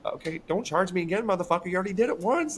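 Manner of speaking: high, indignant complaint; sarcastic protest, pitched well up; mock outrage. Owner to vet about double billing, high indignant whine.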